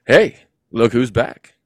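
loud, slow, drawn-out greeting; a booming slow new voice. Acted. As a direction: greet him slowly and loudly. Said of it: "this new voice said".

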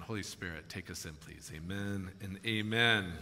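low, softened praying voice; hushed, earnest prayer. Real, not acted. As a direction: pray quietly on behalf of the congregation. Leads congregation in prayer.

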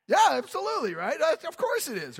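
high, loud, eager assent; emphatic agreement. Acted. Preacher voicing the congregation agreeing, emphatically eager. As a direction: agree loudly with pitch jumping up.

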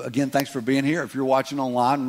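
usual speaking voice

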